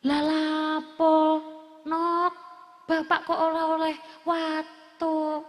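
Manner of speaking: slow, drawn-out father's protest; acted old father. Father asking child why he may not cough, slow protest. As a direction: stretch it out as a puzzled father.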